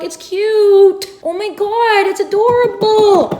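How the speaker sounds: rising squeal of delight; gushing delight. Real, not acted. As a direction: swoon over how adorable it is. Gushing over the little nook.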